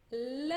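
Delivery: "L" as a bare, held tongue-tip sound; som do L. Shown as a demonstration. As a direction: sound out "L" with tongue behind the top teeth. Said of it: "lá nos dentes de cima". ("L" puro, língua nos dentes de cima)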